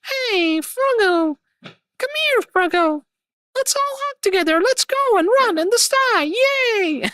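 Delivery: very high, sugary anime waifu voice; cute waifu voice. Pretend. Streamer mocking chat's waifu requests to Froggo, very high cute waifu voice. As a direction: gush it like a bubbly anime girl. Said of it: "I will just turn my voice and be like".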